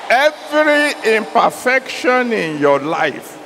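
loud, urgent declaration; preaching at full force. Acted. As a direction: proclaim it over the crowd.

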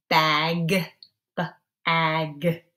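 "bag" with a loud, stretched final g; "B-ag" split as B then a stressed "ag"; exaggerated word endings. Shown as a demonstration. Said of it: "change the ending sound".

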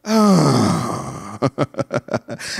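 long, low falling sigh; demonstrated sigh. Shown as a demonstration. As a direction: let out a big sigh on cue. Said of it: "hit me again with the stenazo".

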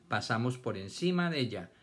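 normal talking voice

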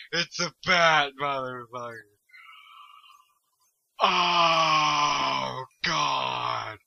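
loud, drawn-out wailing cries; mock panic. Player meeting a bat in the game, wailing mock panic. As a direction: wail it in exaggerated alarm.

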